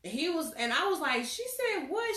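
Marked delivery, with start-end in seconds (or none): 1.2-2.2 high, shocked disbelief. Retold or imitated. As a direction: react stunned. Shocked reaction.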